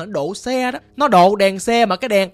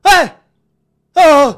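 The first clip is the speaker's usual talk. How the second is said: sudden loud high cries, spaced apart; ghostly night cry; 8 semitones higher, 11 dB louder than usual. Acted. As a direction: let out eerie, loud isolated calls. Cries heard at night in ghost story.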